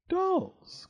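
very high, startled question; surprised cry. A narrator's reading. Bobo hears the creature eats dolls, cries in surprise. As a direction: squeak it upward in surprise. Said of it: "cried Bobo in surprise".

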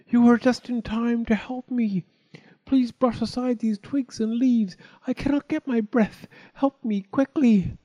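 very high, urgent storybook plea; pleading wind. A narrator's reading. Trapped wind begging the chick for help, desperate.

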